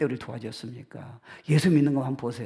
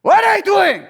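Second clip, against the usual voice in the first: loud, high rousing call; the Lord shouting; 11 dB louder, much slower than usual. Retold or imitated. The Lord rousing his people, loud high shout. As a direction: shout to wake a sleeping lion. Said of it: "주님이".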